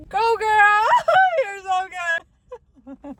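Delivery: high, loud sobbing cheer; tearful cheering. Retold or imitated. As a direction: cheer someone on through exaggerated crying, drawing it out. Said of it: "cheering her up".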